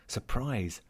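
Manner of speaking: usual speaking voice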